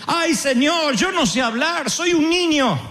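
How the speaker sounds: pleading Jeremiah. Retold or imitated. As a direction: beg like a frightened boy. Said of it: "Jeremías dice". (Jeremías suplicando como un niño)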